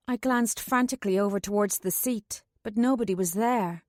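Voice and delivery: usual speaking voice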